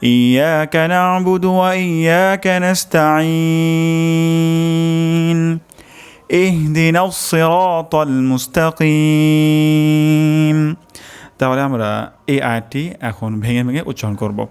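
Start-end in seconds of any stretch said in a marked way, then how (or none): 0.0-10.7 slow, drawn-out melodic tilawat, higher; Quran recitation. Shown as a demonstration. Teacher recites the verses for the students, slow melodic chanting. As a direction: chant each verse slowly with long held vowels.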